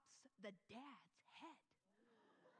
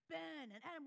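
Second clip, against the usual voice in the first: ordinary talk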